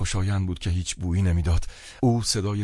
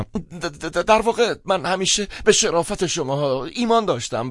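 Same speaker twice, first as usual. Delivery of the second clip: flustered, higher-pitched assurance; stammering; 12 semitones higher, 4 dB louder than usual. A narrator's reading. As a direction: stutter into a high-pitched protest. Stammers while insisting on his belief.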